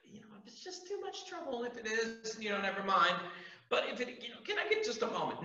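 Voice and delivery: a meek beggar hedging his request to Jesus; timid asking. Pretend. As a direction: apologise your way into asking, barely daring. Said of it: "he wasn't like".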